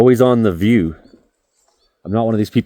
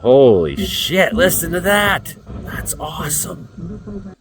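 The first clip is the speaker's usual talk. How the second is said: slow, drawn-out amazement, leaping high; real high-pitched awe; awestruck excitement; 10 semitones higher, slower than usual. Real, not acted. Reacting live to storm sounds.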